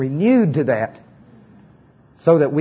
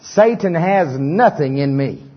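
call-and-response confession; 4 semitones higher, slower than usual. Acted. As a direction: proclaim each phrase boldly, line by line. Leads congregation in confession.

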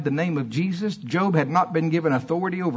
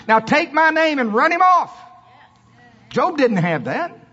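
rising high and loud, proclaiming Jesus' words; preaching at full force; 9 semitones higher, 4 dB louder than usual. Acted. Preacher to church, voicing Jesus, at full force. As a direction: thunder it out to the congregation.

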